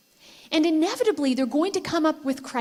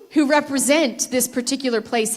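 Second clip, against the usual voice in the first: ordinary talk, nothing marked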